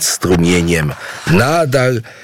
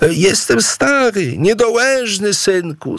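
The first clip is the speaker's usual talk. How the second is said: put-on groaning old man; 13 semitones higher than usual. A narrator's reading. Old father groans excuses to his son. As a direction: creak it out like a frail old father. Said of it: "stęknął Mawungu".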